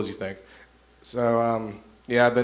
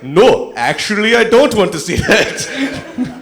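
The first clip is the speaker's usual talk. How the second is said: put-on very loud, high African-accented protest; 8 semitones higher, 9 dB louder, slower than usual. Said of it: "this African man saying".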